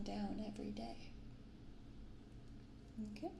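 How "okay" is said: ordinary delivery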